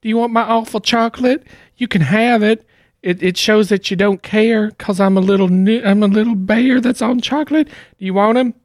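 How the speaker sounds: high cute toy bear voice; teddy bear voice. Acted. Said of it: "He says".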